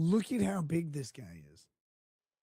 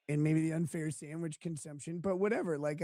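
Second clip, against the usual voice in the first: normal talking voice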